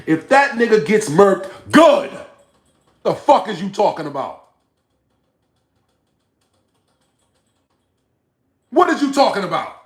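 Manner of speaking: high, loud, indignant shouting; angry outburst. Real, not acted. Streamer rejecting a viewer's sympathy for his rival, angry shouting. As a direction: shout it back in disbelief.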